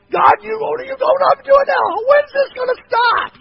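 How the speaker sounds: high, loud cry of frustration at God; exasperated shout. Retold or imitated. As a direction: yell it up at the sky, fed up. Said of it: "And I'm going".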